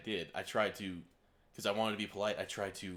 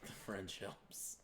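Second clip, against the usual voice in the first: whispered; 12 dB quieter, much slower than usual. Pretend. Joking echo of a quip.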